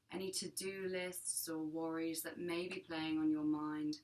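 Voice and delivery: calm, measured meditation voice with long pauses; guided meditation. Acted. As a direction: guide listeners gently into their breath, unhurried and even.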